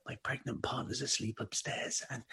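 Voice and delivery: hushed, dropping into a whisper; speaking quietly. Retold or imitated. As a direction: keep it down for someone asleep upstairs. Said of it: "me speaking quietly because".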